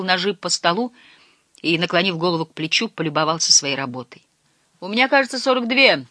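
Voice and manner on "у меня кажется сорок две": higher, tentative voice from overboard; lightly voiced meek man, pitched up; timid character voice. A narrator's reading. Meek fisherman reporting his count from overboard, timid and high. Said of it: "раздался за бортом тихий голос".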